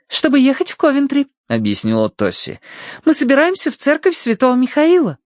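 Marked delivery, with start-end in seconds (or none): 0.1-1.3 high-pitched explaining voice; girlish daughter. A narrator's reading. Daughter explains the trip to father, girlish. 3.0-5.2 put-on high, eager young voice; girlish daughter. A narrator's reading. Daughter tells father about the church, girlish.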